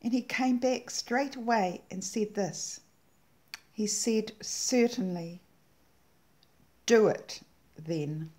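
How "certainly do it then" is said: slow and louder, pressing down on do; with some emphasis. Retold or imitated. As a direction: firmly repeat the command, punching do. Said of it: "he said that with some emphasis".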